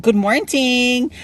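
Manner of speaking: high, slow drawn-out good morning; cheery drawn-out hello; sing-song greeting. Real, not acted. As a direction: stretch it out brightly and high. Greeting viewers while driving.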